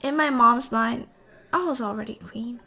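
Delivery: usual speaking voice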